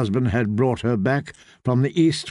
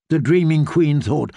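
ordinary delivery

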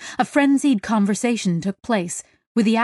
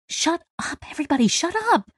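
strained yell; 9 semitones higher, faster than usual. A narrator's reading. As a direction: hush everyone with a high, urgent cry. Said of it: "Rosemary yelled".

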